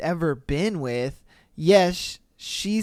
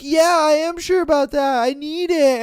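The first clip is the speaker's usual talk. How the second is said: higher, louder insistent wife voice; mock wife insisting; 8 semitones higher, 6 dB louder than usual. Wife defending her Target buy, insisting loudly. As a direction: play the wife digging in on her purchase.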